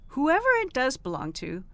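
ordinary delivery

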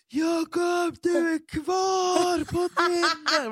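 high-pitched, drawn-out scream retold with laughter; återgivet skrik. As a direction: scream it out in panicked disbelief, stretching the words. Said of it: "jag bara skrek". (utdraget, gällt skrik)

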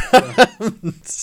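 lauter, hoher Ausruf der Empörung; übertrieben frustriert losrufen; gespielt verärgert. Acted. Mock frustration, nobody knows Hudson. (gespielt empörter Ausruf)

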